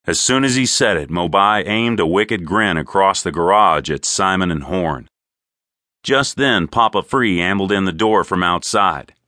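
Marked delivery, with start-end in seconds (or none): none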